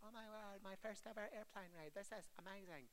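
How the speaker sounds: put-on high, soft voice of a thrilled child; small, high-pitched mock wonder; excited child. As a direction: mimic a kid amazed by a first flight. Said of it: "some children might be like".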